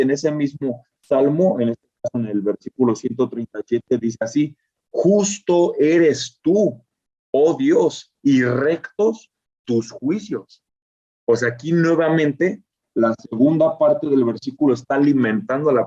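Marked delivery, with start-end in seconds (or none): 4.9-10.6 slow, raised proclaiming of the verse; reading the psalm to the class; solemn scripture reading. Shown as a demonstration. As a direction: declare it slowly, reverently. Reads Psalm 119:137.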